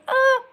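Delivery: high sudden ah of discovery; real startled cry; surprised yelp. Real, not acted. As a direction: yelp as you spot it. Finds the scrolled super chat.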